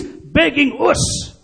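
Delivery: high, slow plea in imitated Irish. Irish rock star begging an elite crowd, high-pitched Irish brogue. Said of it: "in his wonderful Irish brogue".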